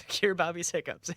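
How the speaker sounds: ordinary talk, nothing marked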